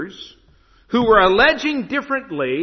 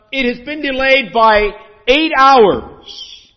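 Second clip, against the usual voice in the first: loud, slow, higher airline announcement; mock gate announcement; 6 semitones higher, 8 dB louder, slower than usual. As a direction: spell out the bad news like an announcer. Airline announces long delay.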